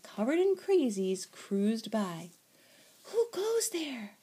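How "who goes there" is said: high, drawn-out mock holler. A narrator's reading. Kitten challenges a passing comet, high holler. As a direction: call out slowly into space, high. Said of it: "hollered Skipito".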